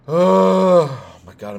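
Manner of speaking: a loud, high, drawn-out "oh my God"; exasperated outburst. Real, not acted. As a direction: explode in frustration, slowly. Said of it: "I'm getting fucking mad".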